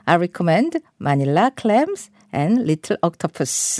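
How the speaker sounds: very slow, clear sentence for learners; slow model reading. Shown as a demonstration. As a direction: read it slowly word by word. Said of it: "마지막으로 한 번 더 들어보세요". (천천히 또박또박 읽기)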